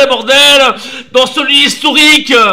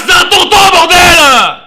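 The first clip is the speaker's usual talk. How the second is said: laughing shout; 3 semitones higher, 3 dB louder than usual. Real, not acted. Rant defending his uncle.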